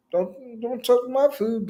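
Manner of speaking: put-on high-pitched protective kid voice; little boy. Little boy guarding his food from Jesus, high-pitched protest. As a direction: imitate a small boy guarding his lunch. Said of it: "the little boy's like".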